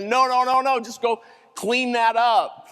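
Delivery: retold dismissive boss. Boss refusing a panicked bagger's twenty, dismissive. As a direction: wave it off urgently, imitating the boss.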